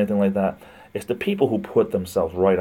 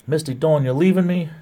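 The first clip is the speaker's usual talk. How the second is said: high, louder, mock-hurt cry; mock dismay; 8 semitones higher, 4 dB louder than usual. Real, not acted. Streamer to viewer Misty Dawn leaving, mock-hurt and high. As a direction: pretend to be wounded she's leaving.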